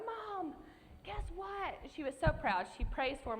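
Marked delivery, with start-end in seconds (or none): 0.0-1.7 much higher, bursting to tell mama; excited news. Retold or imitated. Calling her mom about the scholarship, excited high-pitched. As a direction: squeal it like the best news ever.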